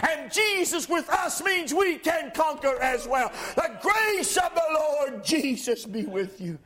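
high, ringing pulpit voice, climbing to a shout; fervent preacher lifting the room; full-force preaching. Acted. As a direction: preach it out to the whole church. Preacher rising again.

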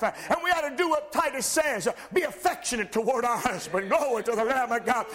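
high, ringing pulpit voice, climbing to a shout; fervent preacher lifting the room; full-force preaching. Acted. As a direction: preach it out to the whole church. Preacher building to climax.

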